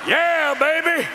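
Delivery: high, drawn-out holler; mock-thrilled high shout; excited cheer. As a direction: whoop it slowly like a rowdy fan. Cheers the fight.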